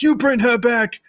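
loud, high angry yell; furious uncle shouting; Vernon yelling. Retold or imitated. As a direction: bark it in his face. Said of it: "Vernon's in his face like".

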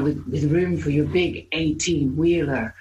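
hint of a sultry on-hold voice-over; lightly voiced brothel phone message. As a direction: read it like a teasing hold-line recording. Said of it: "requested my voice for their on hold".

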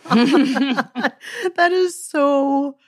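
laughing while gushing. Real, not acted. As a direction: gush through laughter, high and slow. Gushing over old date clip.

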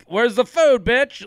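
lightly acted high-pitched boorish guest; mock rude demand. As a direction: imitate an entitled boyfriend demanding to be fed. Voices rude boyfriend arriving at family's.

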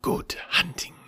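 unvoiced, grim farewell; grim whisper. A narrator's reading. Kaa whispering grimly to Bagheera before gliding away. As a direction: breathe it grimly. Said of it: "said Kaa grimly".

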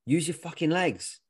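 blunt order from a worn-out coach, retold; tired, blunt coach. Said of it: "I was pretty tired".

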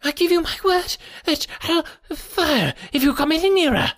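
very high-pitched, quavering warning; reedy, quivering voice. A narrator's reading. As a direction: threaten in a thin, trembling pipe. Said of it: "came a reedy, quivering voice".

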